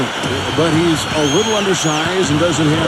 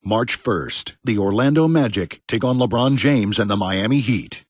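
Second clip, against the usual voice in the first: lower, slow, booming promo voice; promo ad read; 8 semitones lower, 4 dB quieter, slower than usual. Acted. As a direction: sell the big game like a TV spot. Promo for upcoming game.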